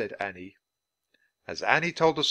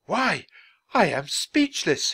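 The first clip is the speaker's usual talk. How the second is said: taken aback, voice jumping high; sputtering surprise; 9 semitones higher, 5 dB louder, slower than usual. A narrator's reading. Dickens learns Annie is a girl, sputtering surprise. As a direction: exclaim it loudly in astonishment. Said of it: "he sputtered".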